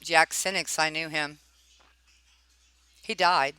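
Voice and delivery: ordinary talk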